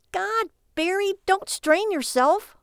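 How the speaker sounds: high, slow, drawn-out sarcasm; mock him for thinking so hard; loud-ending exasperated jibe; sarcastic wife. A narrator's reading. Wife mocks his slow thinking.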